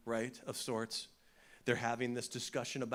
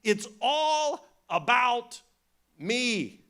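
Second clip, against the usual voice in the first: louder, higher, drawn out; Jesus's voice, emphatic; 8 semitones higher, 10 dB louder, much slower than usual. Retold or imitated. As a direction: proclaim it with grand weight. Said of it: "he's saying things like".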